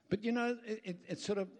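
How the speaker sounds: usual speaking voice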